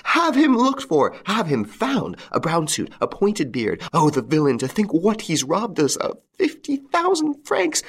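high-pitched, railing tirade; shrieking. A narrator's reading. Wife railing to the police against the inspector, shrieking. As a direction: shriek accusations at the top of the voice. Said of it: "continued to shriek at the top of her voice".

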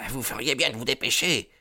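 urgent warning shouted up the stairs; called warning. Acted. Lookout at ground floor calling up to the gang, urgent. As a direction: hurry them along from below. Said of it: "cria l'homme de faction".

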